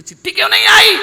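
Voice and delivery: loud, high, very fast question; exasperated shout. Acted. Seth demanding why no letter came, fast loud shout. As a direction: fire the question out in disbelief.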